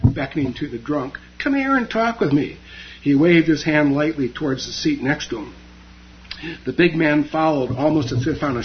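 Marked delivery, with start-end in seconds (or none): 1.4-2.6 easy friendly invitation. A narrator's reading. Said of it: "in an easy Japanese vernacular".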